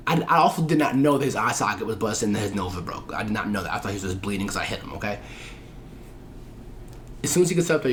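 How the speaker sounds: ordinary talk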